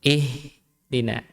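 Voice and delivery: "Ihdina" slow with a long airy h; flowing h. Shown as a demonstration. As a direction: stretch the h in "Ihdina" before "dina". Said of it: "Tapi dia dialirkan".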